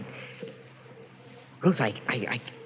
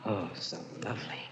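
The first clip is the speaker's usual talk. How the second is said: whisper; 7 semitones lower, 15 dB quieter than usual. Acted. Frank whispers, entranced.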